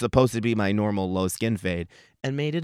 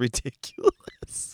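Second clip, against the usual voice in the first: ordinary talk, nothing marked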